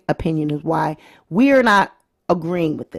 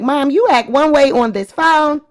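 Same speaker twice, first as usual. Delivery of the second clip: higher-pitched imitation of a child calling out mom; mock daughter's complaint, pitched up; North; 6 semitones higher, 3 dB louder than usual. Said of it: "that's what North was trying to say".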